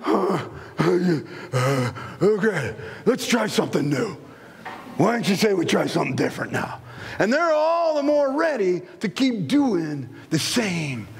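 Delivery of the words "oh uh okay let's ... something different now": winded, lower, pleading to stop; acting out of breath and spent; worn-out adult. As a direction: puff and bargain wearily. Exhausted adult wanting to stop.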